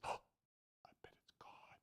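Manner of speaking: barely audible whispered realisation; awed whisper. Acted. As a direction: whisper it to yourself as it dawns on you. Boy realises who is calling.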